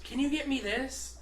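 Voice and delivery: high, imitated sweet request; coworker's request. Coworker asking box guy for supplies, high imitated voice. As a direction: pitch it up as the needy coworker. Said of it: "She goes".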